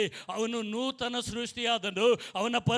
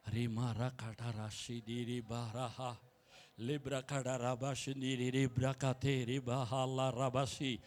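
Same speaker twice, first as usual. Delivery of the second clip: speaking in tongues; 9 semitones lower, much slower than usual. Acted. Pastor praying in tongues before the church, hushed and slow. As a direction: let the syllables flow slowly under the breath.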